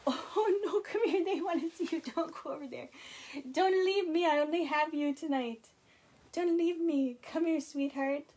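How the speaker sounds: high, loud, laughing coaxing; coaxing the dogs. Real, not acted. Host calling her dogs back on camera, high pleading coax.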